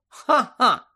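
high, loud, sarcastic "ha-ha". A narrator's reading. Hermione answers Ron's jibe about goblin rights, sarcastically. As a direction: throw out a pointed mock laugh. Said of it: "саркастично сказала Герміона".

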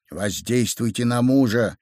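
ordinary delivery

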